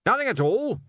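loud, high, blustering archchancellor; blustery old wizard. Acted. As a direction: demand it like a bluff old master.

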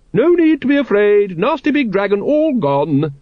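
high, bright, over-cheerful coaxing; bright reassurance. A narrator's reading. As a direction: soothe the nervous dragons with forced cheer. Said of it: "she said brightly".

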